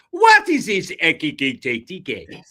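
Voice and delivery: second go at a mock Nigerian accent, Ekitike drawn out. Host retries the Nigerian accent on a co-host's cue. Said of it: "start every sentence with, "What is this?".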